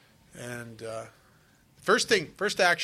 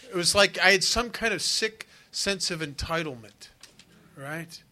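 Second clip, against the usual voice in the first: ordinary talk, nothing marked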